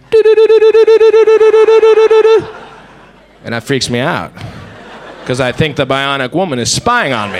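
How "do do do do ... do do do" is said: high, loud rapid-fire do-do-do; imitated signal beeping. Comic showing the paranoia sound he hears, imitated beeping. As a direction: mimic a relentless electronic pulse on one note. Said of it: "more of a paranoia thing I hear".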